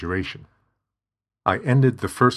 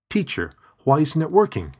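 frustrated plea; 8 semitones higher, 4 dB louder than usual. Acted.

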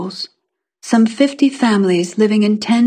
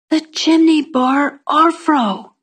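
high, slow, careful repeat; self-conscious girl making herself understood; embarrassed girl; 6 semitones higher, slower than usual. A narrator's reading. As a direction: spell it out slowly in a small high voice.

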